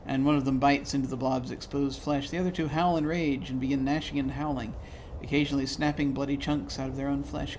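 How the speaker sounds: ordinary talk, nothing marked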